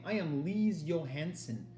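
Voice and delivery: slow, lightly theatrical declaration; a hint of the stage queen; mock grand pronouncement. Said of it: "You should try saying".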